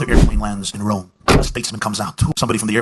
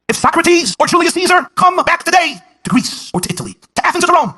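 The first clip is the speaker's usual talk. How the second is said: high, loud preacher's cry; preaching at full force; 7 dB louder than usual. Acted. Herzog presses Toynbee before the audience, fiery preaching. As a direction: drive the point home to the crowd.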